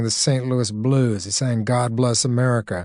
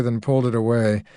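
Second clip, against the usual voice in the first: plain reading voice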